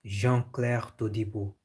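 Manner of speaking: Jean-Claire Todibo said the French way, slow and careful; French-style Jean-Claire Todibo; French pronunciation. Shown as a demonstration. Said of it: "How is bro Todibo Jean-Claire pronounced in French?".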